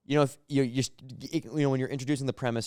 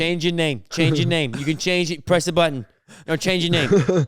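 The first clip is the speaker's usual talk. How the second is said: irritated boss; 4 semitones higher, 4 dB louder than usual. Acted. Boss on zoom.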